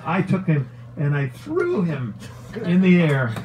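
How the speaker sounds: ordinary talk, nothing marked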